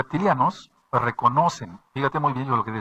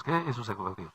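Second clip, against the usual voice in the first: softer, dropped echo of the question; quieter repeat; 8 dB quieter than usual. Real, not acted. Preacher echoes his own question to the congregation, softer. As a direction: say it again, softer and to yourself.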